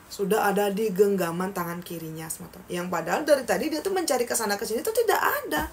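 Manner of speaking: plain reading voice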